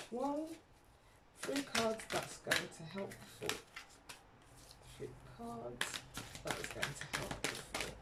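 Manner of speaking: soft, hushed plea to the spirits; hushed request. Real, not acted. Reader softly asks spirit for three cards, hushed.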